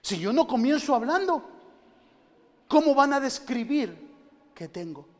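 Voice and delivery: loud, high fervent cry; preaching shout. Acted. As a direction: hammer the point home in a full shout. Preaching peak.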